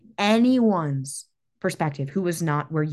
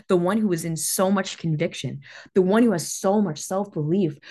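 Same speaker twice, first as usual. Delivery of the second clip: ordinary delivery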